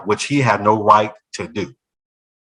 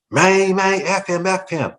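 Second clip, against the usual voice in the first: put-on a panicked teen, much higher; 9 semitones higher than usual. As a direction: blurt it like a scared kid, pitch way up. Daniel panics at probation officer.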